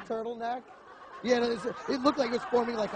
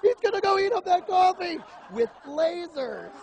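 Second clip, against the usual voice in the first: high, loud, amazed; excited; 9 semitones higher, 6 dB louder than usual. Retold or imitated. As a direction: gush about lasers like a kid. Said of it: "I'm like".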